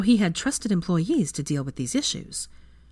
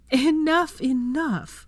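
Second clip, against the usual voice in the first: higher, gasping through laughter; wheezing with laughter; 10 semitones higher, 3 dB louder, much slower than usual. A narrator's reading. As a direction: plead while laughing for breath. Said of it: "wheezed between gasps of laughter".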